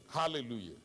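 normal talking voice

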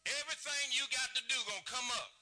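high, loud pulpit preaching; full-force preaching. Acted. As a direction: drive it home to the congregation. Preaching with raised voice.

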